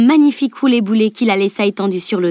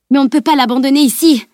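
higher, louder outburst of protest; an indignant, raised-voice objection; exploding; 6 semitones higher, 5 dB louder than usual. A narrator's reading. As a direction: burst out in protest. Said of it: "explosa Camille".

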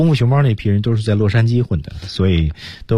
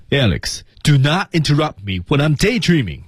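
higher-pitched demo with tightened rhythm; tighter rhythm; 7 semitones higher than usual. Shown as a demonstration. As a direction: keep the line tight and rhythmic. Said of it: "收紧一点点". (节奏收紧一点)

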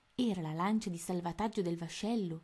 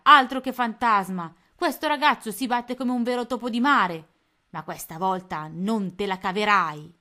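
louder menacing pirate taunt; loud threat; 3 semitones higher, 10 dB louder than usual. A narrator's reading. Pirate captain threatening Tony, loud menace. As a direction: growl the threat at the young mouse. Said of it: "esclamò Codanera".

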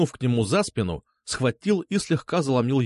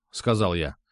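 ordinary delivery, nothing marked

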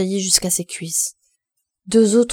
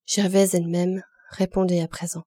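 usual speaking voice

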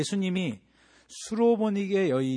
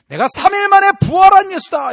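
loud, much higher triumphant boast; mock grand proclamation; 17 dB louder than usual. What Jesus did not say, loud herald boast. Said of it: "이렇게 얘기하지 않습니다".

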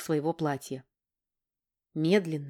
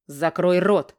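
angry command; 6 dB louder, slower than usual. Acted. King silencing his son.

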